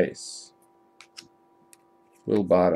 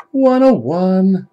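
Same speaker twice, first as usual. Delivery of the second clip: higher, louder, slow drawn-out call; excited announcement; 9 semitones higher, 7 dB louder, much slower than usual. Real, not acted. Showing viewers the rare card, slow excited call. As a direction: savour each word like a big reveal.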